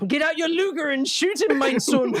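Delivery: high, hammed-up comic command; Hitler impression. Hitler in hell ordering his son, hammed-up.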